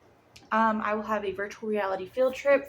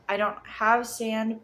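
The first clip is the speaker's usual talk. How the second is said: ordinary talk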